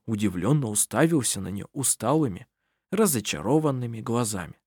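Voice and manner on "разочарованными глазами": usual speaking voice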